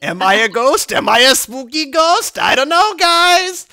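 high, loud mock-spooky ghost taunt; teasing spooky voice. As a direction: taunt them like a playful ghost. Voicing Jesus teasing the disciples.

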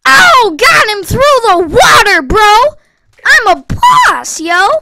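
loud, high, hyped bragging; triumphant shout. Real, not acted. Gamer who got a kill through the water, triumphant shout. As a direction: whoop it out like a champion.

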